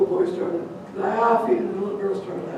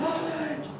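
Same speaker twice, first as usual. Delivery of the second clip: gasp; 9 semitones higher, 7 dB quieter than usual. Acted. Sister sits down.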